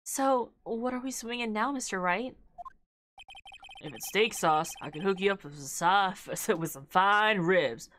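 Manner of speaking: lightly drawled southern cowboy, easygoing; southern cowboy accent. Acted. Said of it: "doing a, a southern accent character".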